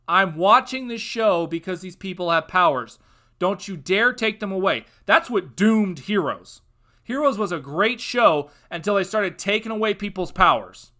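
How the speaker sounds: loud, heated warning; angry rant. Real, not acted. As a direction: scold the writers hard. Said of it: "I apologize for that outburst".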